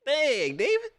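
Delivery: very high, drawn-out exclaim; mock outrage at David; high-pitched disbelief at the reporter; exasperated dang. Real, not acted. Reacting to the reporter again.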